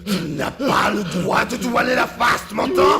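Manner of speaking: furious shout. Acted. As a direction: roar at him for denying you. Voice furious at being denied. (crié avec fureur, beaucoup plus aigu)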